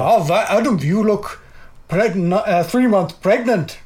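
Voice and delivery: put-on halting, botched Polish-Russian accent; retry a Polish woman's accent, haltingly; attempted Polish accent. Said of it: "No, it's terrible".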